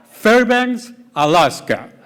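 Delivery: loud, high burst of disbelief; shocked outburst. Real, not acted. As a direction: blurt it out in shock. Shocked reading of new orders.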